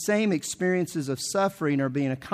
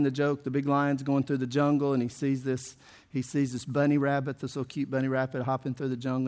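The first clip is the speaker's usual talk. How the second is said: ordinary talk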